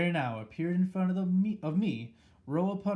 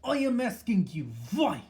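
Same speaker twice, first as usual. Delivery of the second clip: much higher, louder demanding scream; 7 semitones higher, 6 dB louder than usual. A narrator's reading. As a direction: demand an answer at full pitch. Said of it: "she screamed".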